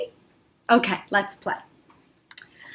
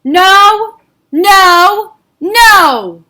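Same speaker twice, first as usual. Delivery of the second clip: loud angry refusal, each no punched; a stubborn king yelling no; Paro shouting no; 7 semitones higher, 9 dB louder, much slower than usual. Acted. Paro refuses Moshe, shouting angrily. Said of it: "he was shouting".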